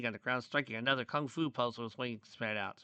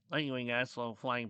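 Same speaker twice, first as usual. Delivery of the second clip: usual speaking voice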